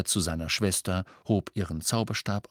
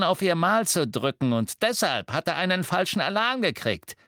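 scheming, sneering henchman; oily, plotting villain voice; sly Death Eater; 8 semitones higher than usual. Acted. Amicus plotting a lie.

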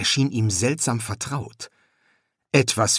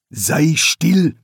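sharp, louder rebuke; 6 semitones higher, 7 dB louder than usual. Acted. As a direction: snap at the son. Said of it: "erwiderte Ptaklusp scharf".

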